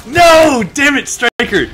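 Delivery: loud, high shout. Real, not acted. Gamer shouting as he gets killed, loud.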